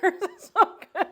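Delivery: high laughing delight, building to a loud burst; real laughter. Real, not acted. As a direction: laugh helplessly through the praise. Laughing over how good it tastes.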